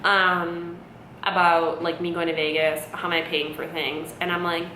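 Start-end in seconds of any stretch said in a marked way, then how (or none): none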